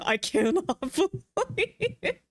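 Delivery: high, slow laughing disbelief. Real, not acted. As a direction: laugh out the words in shock. Laughing at her own mistake.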